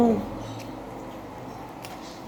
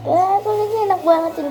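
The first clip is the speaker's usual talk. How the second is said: excited; 8 semitones higher, faster than usual. Real, not acted. Savoring favorite chicken skin.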